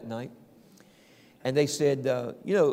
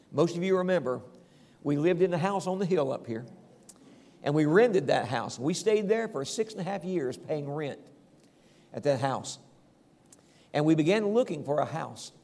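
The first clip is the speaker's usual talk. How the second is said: ordinary talk, nothing marked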